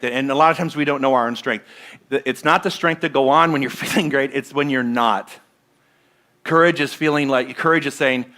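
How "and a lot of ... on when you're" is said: usual speaking voice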